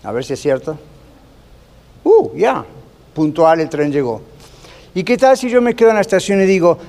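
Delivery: usual speaking voice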